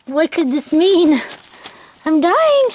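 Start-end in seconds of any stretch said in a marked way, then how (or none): 2.0-2.7 high squeal of excitement; giddy, pitch shooting up; excited. Real, not acted. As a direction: squeak it out, overwhelmed. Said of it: "I'm so excited".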